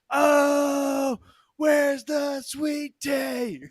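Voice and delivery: put-on very high, drawn-out drunken holler; drunken shout. Drunk shopper demanding sweet tea from worker, high drawn-out shout. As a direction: wail it out slowly, high and loud.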